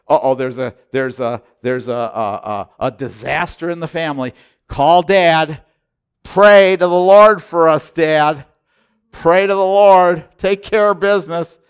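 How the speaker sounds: mock panic of children begging Dad; a hint of panicky kids; imitating his kids. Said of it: "my kids, their answer".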